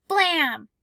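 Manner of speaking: a boy's pretend shot; mock gunshot.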